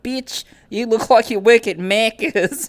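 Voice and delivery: a cheeky Australian insult, hammed up a bit; playful Australian banter voice; mock Australian. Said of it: "the equivalent of that in Australian talk".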